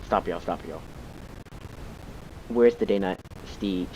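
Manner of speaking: ordinary delivery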